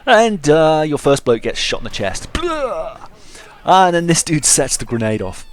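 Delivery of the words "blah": high squeaky "Blah!" death yelp; comic death cry. Pretend. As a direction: yelp like a cartoon soldier dying. Soldier shot in chest.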